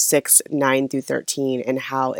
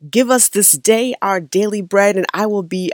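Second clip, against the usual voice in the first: higher, louder preacher's cadence; churchy pastor voice; 5 semitones higher, 4 dB louder than usual. Retold or imitated. Speaker recalls the hometown pastor reciting scripture, churchy preacher voice. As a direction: intone it like a Baptist pastor from back home. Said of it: "in my churchy voice".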